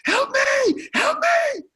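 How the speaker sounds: very high, loud panicked scream. Retold or imitated. Publicist panicking in the sea, screaming for help. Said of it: "he broke into his panic".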